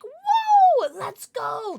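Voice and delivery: high excited squeal of surprise; surprised whoa. Real, not acted. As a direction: gasp out a delighted whoa. Reacting to streak in chat.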